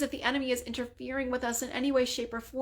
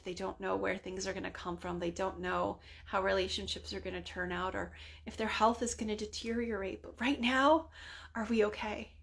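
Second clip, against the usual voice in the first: normal talking voice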